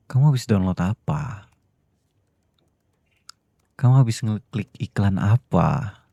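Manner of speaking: frustrated interrogation. Acted. Husband interrogating wife over deleted data.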